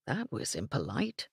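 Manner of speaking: low, soft disapproving mutter. Acted. Englishman grumbling after the rude cowboy, softly muttered. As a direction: mutter it under your breath. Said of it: "Evan muttered".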